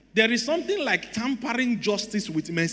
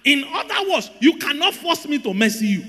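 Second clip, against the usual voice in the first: high-pitched, emphatic sermon delivery; preacher driving the point home; full-force preaching; 6 semitones higher, slower than usual. Real, not acted. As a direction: preach it with urgent force to the congregation.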